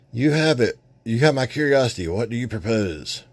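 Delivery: high, eager villain reply; intrigued Skeletor. Acted. Skeletor answering the stranger, high eager tone. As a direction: lean in with greedy interest.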